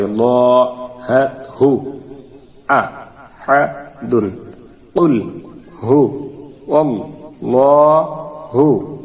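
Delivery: each syllable said apart with pauses; broken-up syllables. Shown as a demonstration. Teacher drills students in broken syllables. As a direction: chop it syllable by syllable. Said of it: "ভাঙ্গা ভাঙ্গা উচ্চারণ করি".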